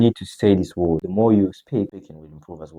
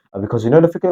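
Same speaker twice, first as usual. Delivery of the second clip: garbled rush, higher and louder; mimicked rushed speech; 5 semitones higher, 6 dB louder, faster than usual. Shown as a demonstration. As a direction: imitate tripping over hurried English. Mimics rushed, failing English speech.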